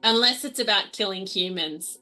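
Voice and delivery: ordinary talk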